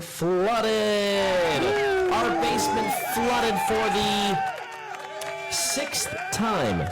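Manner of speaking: high, slow exasperated shout; exasperated outburst. Real, not acted. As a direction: cry out in disbelief. Exasperated about the flooded basement.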